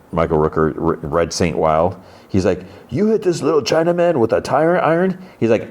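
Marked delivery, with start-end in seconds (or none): 2.9-5.2 high-pitched put-on voice, mock disbelief; incredulous question. Red challenges Economos over the tire iron, mock incredulous.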